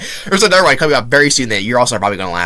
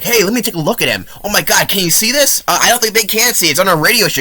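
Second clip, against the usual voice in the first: mocking the radio guy; 7 semitones higher than usual. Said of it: "Then the radio guy is like".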